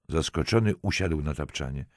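ordinary delivery

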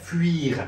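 "fuir" as f-ü-eer; fuir with wee glide. Shown as a demonstration. Teacher answering how it is pronounced, "fuir" with a ü-ee glide. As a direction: say "fuir" with the u gliding into ee.

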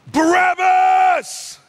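high, drawn-out shout; crowd shout. Retold or imitated. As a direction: yell the name like a mob demanding it. Acting out crowd shouting its choice.

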